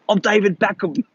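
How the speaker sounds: mock British accent, lightly done; playful hint of a British accent announcing himself; David Beckham. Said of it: "my terrible, terrible accents".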